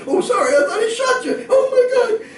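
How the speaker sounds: high, loud, shaken cry; a rattled cop apologizing loudly; flustered apology. Retold or imitated. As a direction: blurt it out rattled.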